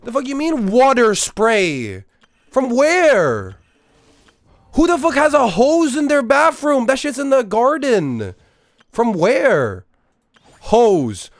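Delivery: high, loud, disbelieving rant; incredulous outburst. Real, not acted. Streamer baffled by advice to spray water in the bathroom, incredulous shouting.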